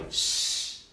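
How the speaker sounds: a long unvoiced s hiss. Shown as a demonstration. Teacher hissing the S sound for students. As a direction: hiss the letter S on its own. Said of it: "You have the sound s twice".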